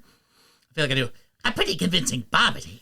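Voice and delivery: put-on cartoon wizard voice; odd little cartoon sorcerer; Babidi. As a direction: brag in a silly villain voice. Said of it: "Your Bobbity's fantastic".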